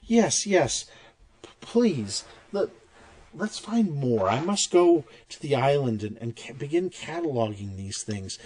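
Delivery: high, eager voice bubbling with relief; relieved noblewoman. Acted. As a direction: gush with renewed hope.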